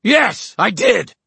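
very high, loud furious scream; full-out high-pitched shout. A narrator's reading. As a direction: scream the admission in a rage. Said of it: "Horace screamed".

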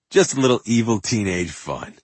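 ordinary delivery, nothing marked

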